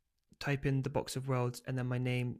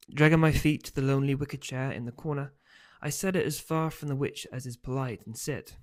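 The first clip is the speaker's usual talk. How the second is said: story narration; 4 dB louder than usual. A narrator's reading. Author reads novel aloud.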